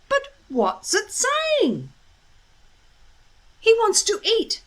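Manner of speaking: put-on a young Viking, a touch anxious; worried boy. A narrator's reading. Boys puzzle over the hungry dragon, lightly voiced.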